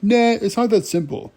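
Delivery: high-pitched brush-off; mock dismissive. Dad brushing off Pocahontas's peace idea, dismissive.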